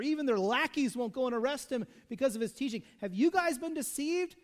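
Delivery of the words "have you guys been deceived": high, louder scornful jab; mocking outrage. Preacher mimicking frustrated Pharisees, higher and louder. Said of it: "irrational arguments coming out of these guys".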